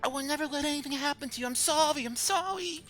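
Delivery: very high, fast squeaky apology; squeaky, gushing apology to a treasured thing; doting little creature. Acted. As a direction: coo frantically in a tiny high voice. Crick fawning over his blade.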